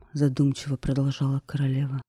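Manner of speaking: plain reading voice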